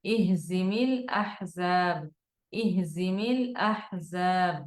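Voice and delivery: ধীর, প্রতিটি অক্ষর স্পষ্ট; শিক্ষকের মতো ধীরে উচ্চারণ দেখান; careful slow recitation. Shown as a demonstration. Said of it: "খেয়াল করব". (ধীরে স্পষ্ট তিলাওয়াত)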